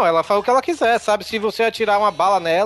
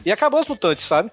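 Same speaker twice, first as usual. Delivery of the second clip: usual speaking voice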